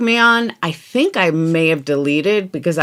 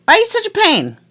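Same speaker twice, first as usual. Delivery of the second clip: mock scolding; 13 semitones higher, faster than usual. Scolding the paint, high voice.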